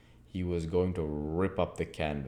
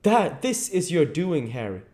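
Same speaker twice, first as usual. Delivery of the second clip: higher, louder bitter reproach; bitter accusing voice; bitterly; 11 semitones higher, 7 dB louder than usual. A narrator's reading. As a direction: blame him with bitterness. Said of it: "said Hallward bitterly".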